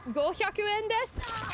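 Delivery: "Gohyaku en desu" slow, high and climbing; questioning. Retold or imitated. Tourist asking the price in Japanese, questioning. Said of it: "Question mark?".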